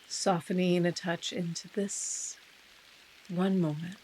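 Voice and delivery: unhurried meditation guide with long pauses; guided meditation. Real, not acted. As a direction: ease listeners into stillness, softening as phrases end.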